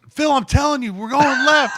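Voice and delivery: yelling. Retold or imitated. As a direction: shout the protection call at a teammate. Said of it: "they're just yelling at each other".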